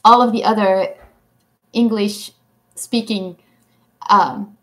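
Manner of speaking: normal talking voice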